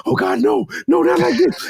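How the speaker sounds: high-pitched comic pleading; mock panic attack. Patient in the scanner facing surgery, panicking comically. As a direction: act out a full-blown panic, very high. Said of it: "The full-blown panic attack".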